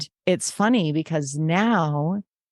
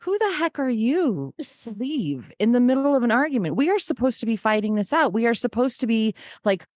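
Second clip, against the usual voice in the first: high, outraged mock rant at the boyfriend; indignant past self; 6 semitones higher than usual. To the boyfriend who walked out, a high indignant rant. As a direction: play your younger self, huffy and indignant. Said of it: "and I was like".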